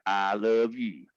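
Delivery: tender, drawn out; slow and tender. Shown as a demonstration. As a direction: slowly spell out I love you. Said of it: "it means I ... L ... Y".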